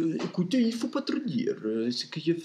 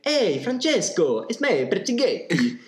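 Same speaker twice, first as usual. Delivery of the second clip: high, slow, loud greeting; cheery hail; 7 semitones higher, 3 dB louder, slower than usual. Acted. Pertighetti hails the pope on the balcony, high and loud. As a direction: call out to an old friend.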